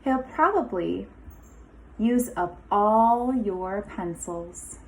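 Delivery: lively storybook read-aloud with a sing-song lilt; picture-book voice with rising, inviting phrases; reading to children. Acted. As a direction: read it like story time for a class of little kids. Teacher reads picture book aloud.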